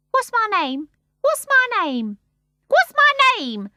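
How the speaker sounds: put-on starling begging the baby to remember him; shrill anxious. A narrator's reading. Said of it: "in a shrill, anxious voice".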